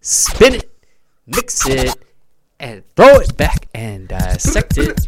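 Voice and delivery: loud, slow DJ chant; two DJs chant their slogan; hype catchphrase. Acted. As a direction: call it out like a DJ drop. Radio hosts' hype catchphrase.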